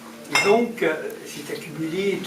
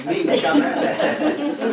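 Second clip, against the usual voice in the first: amused, breaking into laughter; laughing; 11 semitones higher, 3 dB louder, much slower than usual. Real, not acted. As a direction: say it through a rising laugh. Joking aside about the wood. (en riant franchement)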